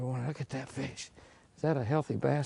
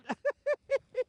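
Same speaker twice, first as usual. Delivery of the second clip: laugh. Real, not acted. Laughing at self-insult.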